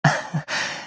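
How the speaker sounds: short, breathy little laugh; soft chuckle. Acted. Denying any slacking, with a soft chuckle. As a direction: chuckle softly under the breath.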